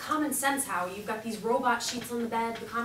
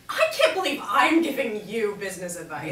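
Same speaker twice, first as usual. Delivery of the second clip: exasperated; 7 dB louder than usual. Acted. Bickering over selling the house.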